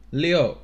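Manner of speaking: short, sharp fall on "六"; falling fourth tone. Shown as a demonstration. Teacher marking tones on numbers, emphatic and falling. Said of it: "fourth tone".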